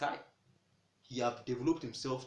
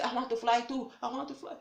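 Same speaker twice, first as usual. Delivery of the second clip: higher and louder desperate cry; panicked plea; 12 semitones higher, 5 dB louder than usual. Retold or imitated. Doomed chickens crying out to the butcher, panicked. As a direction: beg in panic.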